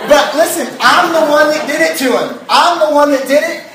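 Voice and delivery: emphatic preaching. Real, not acted. Pastor confessing to the crowd he hurt his son, emphatic. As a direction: press the point with rising passion. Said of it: "preaching earnestly, raised voice".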